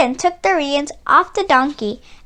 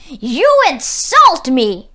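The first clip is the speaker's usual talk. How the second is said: slow, loud furious scream; tyrant screaming; 6 dB louder, slower than usual. A narrator's reading. Tyrant screams at Mustafa over the donkey, slow and loud. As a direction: bellow it as the enraged tyrant. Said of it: "the tyrant screamed".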